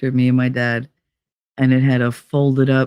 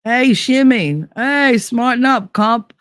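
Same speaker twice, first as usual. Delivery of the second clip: slow, drawn-out local Hoboken slang; a light Hoboken greeting, slow and drawn; Hoboken lingo; 7 semitones higher, slower than usual. Retold or imitated. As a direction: call out like a born and raised Hoboken local. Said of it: "our lingo".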